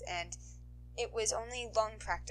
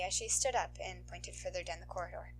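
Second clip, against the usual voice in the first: plain reading voice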